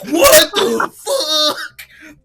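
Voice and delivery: very high, loud, stretched-out scream; mock shocked yell. Viewer reacting to the reveal, hammed-up shock. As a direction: bellow each word in hammed-up disbelief.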